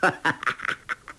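short rattling villain's laugh; 哈哈笑. A narrator's reading. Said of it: "哈哈笑道". (哈哈几声干笑)

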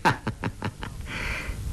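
breathy, voiceless smug chuckle; falling unvoiced snicker; smug laugh. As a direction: laugh quietly at his trap. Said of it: "欧阳克笑道".

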